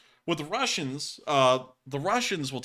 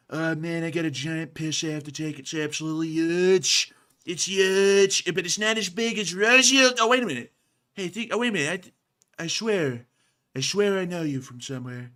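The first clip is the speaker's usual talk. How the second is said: higher, louder brash Trump voice; Trump impression; 5 semitones higher, 5 dB louder than usual. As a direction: boast like a loud brash tycoon. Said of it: "orange man enters with a full bladder".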